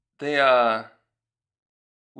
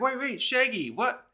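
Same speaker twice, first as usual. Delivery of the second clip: high-pitched, incredulous acted plea; urgent mock protest; 9 semitones higher than usual. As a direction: imitate someone desperately stopping a caller hanging up. Acted plea as caller hangs up.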